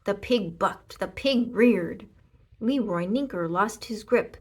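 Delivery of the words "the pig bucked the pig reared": ordinary delivery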